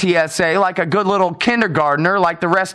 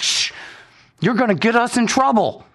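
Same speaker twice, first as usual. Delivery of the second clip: higher, nervous hushing of a timid passenger; hushing fellow traveler; 6 semitones higher than usual. Retold or imitated. Fellow passengers hushing the loudmouth, nervous shush. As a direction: shush him like a scared bystander. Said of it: "people looking at me like".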